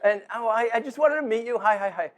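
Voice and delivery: put-on high, bubbly girlish voice with a laugh; bubbly young girl. Father at a wedding toast imitating Claire's eager greeting with a high laughing voice. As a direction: imitate an excited young woman meeting her friend's parents. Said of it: "cute little girl ... great big smile goes".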